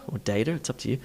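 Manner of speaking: normal talking voice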